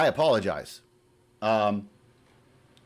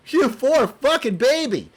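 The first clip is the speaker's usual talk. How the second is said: louder, higher mock pity; sarcastic mock pity; 5 semitones higher, 4 dB louder than usual. Real, not acted. Taunting the letter writer, in loud mock pity. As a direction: sneer it out in mock sympathy. Mocking the complainer.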